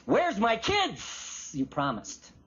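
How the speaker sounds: slow, drawn-out blunt complaint; street-talk demand. Acted. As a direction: demand it of God with no formality. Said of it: "It's street talk".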